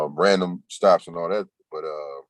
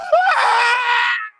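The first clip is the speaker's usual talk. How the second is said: sudden high shrieking laugh; a real, loud, high cackle of laughter; loud burst of laughter; 6 dB louder than usual. Real, not acted. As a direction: crack up loudly at the joke. Bursts out at chat joke.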